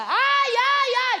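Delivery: put-on high, loud boyish ai ai. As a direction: wail like a hurting child. Menino com dor chega em casa. (gemido de criança com dor, agudo)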